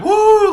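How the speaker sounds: high, loud whooping cheer; mock whoop. Imitating friends messing around on a video, loud whoop. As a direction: let out a high-pitched "woo". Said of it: "like, kinda laughing and messing around".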